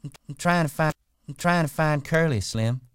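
put-on high, loud coy young woman. A narrator's reading. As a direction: play her sweet and coy. Curley's wife voiced high.

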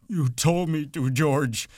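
high, loud, wailing protest; wretched, whimpering self-defence; miserable. A narrator's reading. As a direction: wail it miserably, cowering. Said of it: "he said miserably".

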